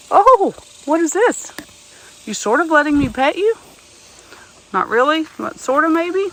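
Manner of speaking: high, coaxing sing-song; pet talk. Real, not acted. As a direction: coax the donkey gently. Coaxing a donkey to be petted.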